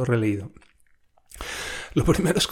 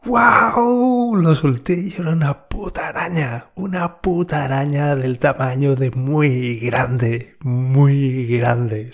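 spider fright; 2 semitones higher, 5 dB louder, slower than usual. Retold or imitated. Dropping the huge spider, a loud scared shout. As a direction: shriek then rant about the spider.

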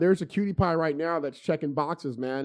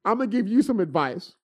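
fired-up advice; 5 semitones higher, 6 dB louder than usual. Retold or imitated. Retelling his heated advice to neighbor.

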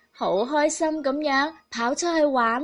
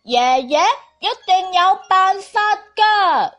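put-on higher, louder, insistent child voice; confident child; 5 semitones higher, 6 dB louder than usual. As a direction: declare it proudly like a little boy. Child insists grandpa can fix.

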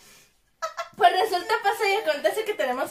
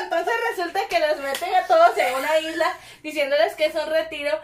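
ordinary talk

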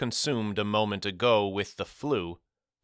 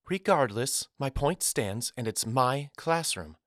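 higher woman's voice, firmly closing the matter; note of finality; 5 semitones higher than usual. A narrator's reading. Teacher claiming her classroom to Caleb, with finality. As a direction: lay down the rule, pitched up. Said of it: "said Miss Carrington with a note of finality".

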